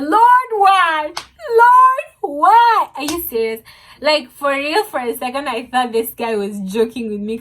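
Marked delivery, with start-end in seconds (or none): 0.0-2.9 high, loud wail to the heavens; mock despair at her fate; anguished cry. Crying out at the diagnosis.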